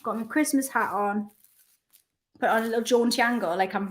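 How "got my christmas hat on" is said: ordinary talk, nothing marked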